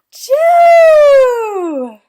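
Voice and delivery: very loud, high shouted choo; the biggest sneeze yet; enormous sneeze. Acted. Elephant sneezes Piggy away, enormous choo.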